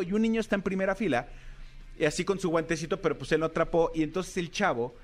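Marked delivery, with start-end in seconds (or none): none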